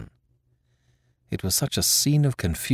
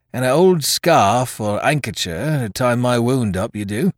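higher, louder seaman's voice driving a bargain; wily pirate sailor; 4 semitones higher, 5 dB louder than usual. Acted. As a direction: bargain like a wounded mutineer, in sailor's dialect.

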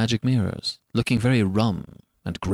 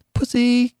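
put-on high excited child call; 11 semitones higher, 3 dB louder than usual. A narrator's reading. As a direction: call out eagerly to the rabbit. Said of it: "he cried".

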